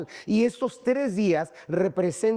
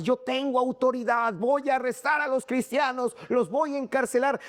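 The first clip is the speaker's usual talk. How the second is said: higher-pitched boast of authority; proud Saul; 7 semitones higher than usual. Acted. Preacher acting Saul boasting of his powers, proudly. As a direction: declare it with self-important pride. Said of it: "del orgullo, porque él tenía cartas".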